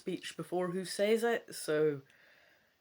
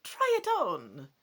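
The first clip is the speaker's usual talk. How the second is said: aansporend; 5 semitones higher, 6 dB louder than usual. Acted. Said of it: "Madame Ursula urges you".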